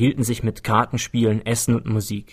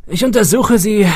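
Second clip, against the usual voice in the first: higher, louder, friendly host voice; genial old man; 10 semitones higher, 6 dB louder than usual. A narrator's reading. Woodstock welcoming wary detective, cheerful and reassuring. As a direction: reassure the nervous guest jovially.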